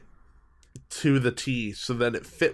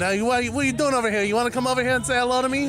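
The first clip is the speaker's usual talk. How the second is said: put-on higher, louder New York tough-guy accent, picking up speed; 9 semitones higher, 4 dB louder, faster than usual. Husband showing his Vinny New York accent to his wife. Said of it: "I would go into this accent like that".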